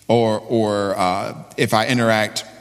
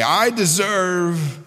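high, slow, drawn-out mockery; mock entitlement; 10 semitones higher, much slower than usual. Preacher mimics Jesus followers to the congregation, mock entitled. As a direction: draw it out high like someone entitled. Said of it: "When I hear Jesus followers say".

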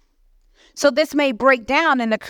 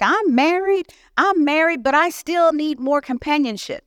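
higher mock voice of an elderly woman; old woman voice; 6 semitones higher than usual. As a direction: voice her like a sprightly old lady. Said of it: "She's like".

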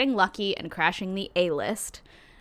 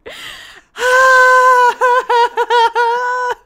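high helpless cackle; loud shrieking laugh; 11 dB louder than usual. Real, not acted. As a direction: scream with laughter. Bursts out after the creepy story.

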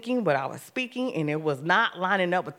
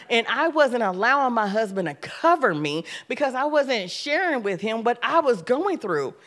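usual speaking voice

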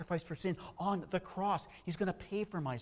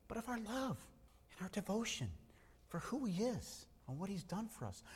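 soft and tender; 4 semitones higher, 10 dB quieter than usual. Real, not acted. Preacher softly urging the congregation to love Christ, hushed. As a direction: drop to a hushed, tender appeal.

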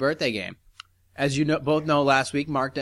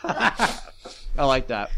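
laughing; much slower than usual. Real, not acted. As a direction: laugh through the words. Laughing at the jingle.

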